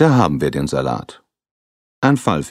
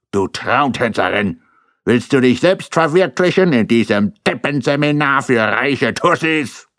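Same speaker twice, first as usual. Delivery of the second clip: higher, louder scornful yell; shouting husband; 9 semitones higher, 4 dB louder than usual. Acted. Schirner shouting at Birgit, angry and louder, slowing on the insult. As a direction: berate her as a fool. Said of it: "Schirner schrie".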